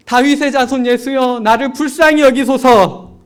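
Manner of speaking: loud, high, slow pleading cry. Retold or imitated. Blind beggar shouting over the crowd, loud slow plea. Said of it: "더 큰 목소리로 얘기하는".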